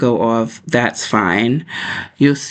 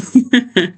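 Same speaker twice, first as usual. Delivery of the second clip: quick high giggle; high laugh; 10 semitones higher than usual. Real, not acted. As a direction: burst into a light giggle. Laughs after mentioning bedtime.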